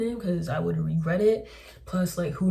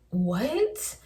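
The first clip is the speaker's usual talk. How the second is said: drawn-out, rising what; baffled, stretching the word upward; incredulous; 5 semitones higher than usual. Retold or imitated. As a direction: say it slowly in sheer disbelief. Baffled reaction to ex's remarks.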